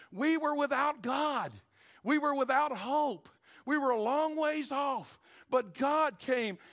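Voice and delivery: slow, loud and high, shouting out each line; slow shouted preaching of a lost people; full-force preaching. Acted. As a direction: thunder it from the pulpit.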